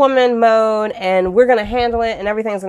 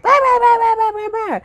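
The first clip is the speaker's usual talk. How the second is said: mock chihuahua barking, much higher; yapping chihuahua. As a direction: yap at the street like a little dog. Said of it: "I was like the chihuahua when somebody walks down".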